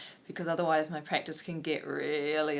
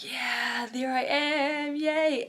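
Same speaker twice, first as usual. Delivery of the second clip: higher, louder, slow drawn-out joyful cheer; a stretched-out happy yay; delighted cheer; 9 semitones higher, 7 dB louder, much slower than usual. Retold or imitated. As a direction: celebrate finding yourself again, slowly. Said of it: "And I was like".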